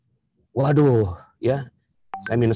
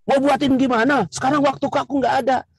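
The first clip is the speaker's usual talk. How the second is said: high, snippy husband voice; husband scolding his wife for the mistake; 12 semitones higher than usual. Acted. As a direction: nag her with a raised, sharp voice.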